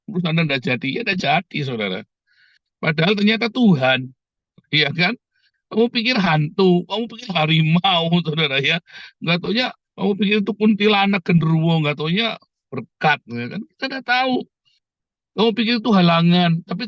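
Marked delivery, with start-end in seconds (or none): none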